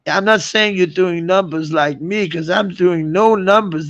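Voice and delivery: ordinary talk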